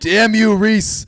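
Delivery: high, loud hammed-up curse; over-the-top fake fury; mock rage. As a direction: shriek it like a cartoon villain. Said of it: "angry fit of rage".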